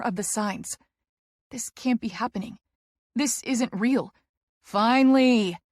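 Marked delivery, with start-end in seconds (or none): 4.6-5.6 1 loud cry. A narrator's reading. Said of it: "Tess cries".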